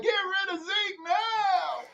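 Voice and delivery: high, slow mock crying wail of a fan; mock the fans with a high, slow, sobbing whine; mock whiny fan. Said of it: "Pollard lovers are like".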